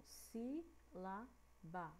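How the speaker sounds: si, la, ba said as separate beats; syllables one by one. Shown as a demonstration. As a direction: split the word si, la, ba into clean syllables. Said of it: "Cada uma delas".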